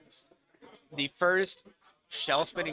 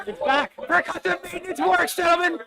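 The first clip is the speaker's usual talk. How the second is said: high, loud cheering; excited shout; 8 dB louder than usual. Real, not acted. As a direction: yell it out in triumph. Robot comes back to life.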